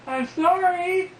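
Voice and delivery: tiny high slow apology; meek little high voice, slow. Retold or imitated. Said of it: "this little voice".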